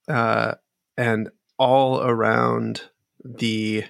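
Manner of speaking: ordinary delivery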